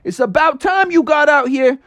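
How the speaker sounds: high-pitched gang of pals; rowdy cartoon kid greeting; Froggy's buddies. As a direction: greet your friend with high, excited hollers.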